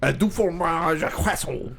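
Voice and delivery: lighter mock French accent, show-off. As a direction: prove you can do a French accent. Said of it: "Put me up against any Frenchie".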